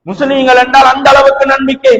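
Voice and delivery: normal talking voice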